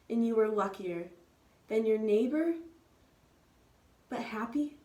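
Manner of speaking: plain reading voice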